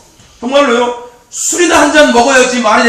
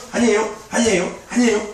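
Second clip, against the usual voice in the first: shy denial; 3 semitones lower, 7 dB quieter than usual. Retold or imitated. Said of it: "수줍음을 타니까 얘가 맨날 이러고".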